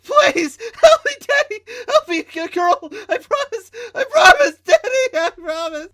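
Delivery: very high, very loud sobbing screams; hysterical screaming. Acted. Trisha begging her father for help, hysterical screaming. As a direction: shriek and beg in pure terror. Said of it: "screaming in pure hysterics".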